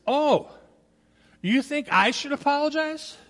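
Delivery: imitating a father taken aback; mock incredulous dad. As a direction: toss it back as a disbelieving question. Retold dad challenges son's expectation.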